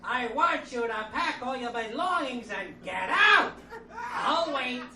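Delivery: slow, loud, screechy yelling in a mock wife voice; hammed-up shrill scolding; Gilbert Gottfried impression. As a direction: shriek it out like an angry wife. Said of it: "Sometimes she sounds like Gilbert Gottfried".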